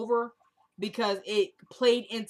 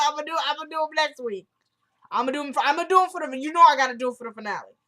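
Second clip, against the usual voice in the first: excited; 5 semitones higher, 5 dB louder, faster than usual. Real, not acted. Excitedly promising shout-outs next week.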